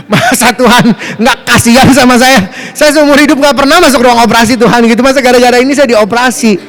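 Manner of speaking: merajuk sambil tertawa, lebih keras; tawar-menawar dengan Tuhan sambil tertawa. Real, not acted. (merajuk sambil tertawa pada Tuhan)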